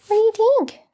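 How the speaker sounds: very high-pitched cooing question; pet voice. Real, not acted. Owner asking Griffin the bunny, high pet voice. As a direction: ask it high, as if to a little bunny.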